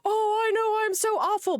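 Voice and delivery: high mocking self-pity whine. Streamer parodying a woman's fake guilt, high mocking whine. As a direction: parody her fishing for reassurance. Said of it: "They're like".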